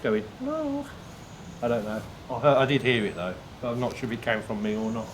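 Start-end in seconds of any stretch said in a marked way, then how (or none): 0.4-0.9 high, soft exhaled hello; breathy high hello. Retold or imitated. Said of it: "it might have been my going".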